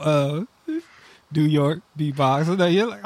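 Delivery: ordinary talk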